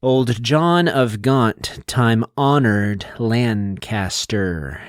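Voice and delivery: put-on "Old John of Gaunt, time-honored Lancaster" slowly, leaning on every second syllable; exaggerated iambic stress. Shown as a demonstration. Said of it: "you're not supposed to say it like that".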